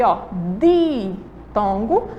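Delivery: very slow, deliberate explanation. Shown as a demonstration. Teacher to pupils, very slowly explaining the term. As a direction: spell it out word by word. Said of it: "pra facilitar aí".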